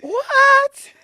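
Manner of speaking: very high, loud disbelieving shriek; shocked. Retold or imitated. Hearing she won the presidential award, shrieking in shock. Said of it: "I'm like".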